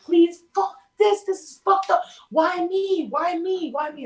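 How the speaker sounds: high, loud desperate cry that fades by the last why me; anguished pleading. Retold or imitated. Praying on her knees to God, anguished angry pleading. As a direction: plead with God in raw frustration.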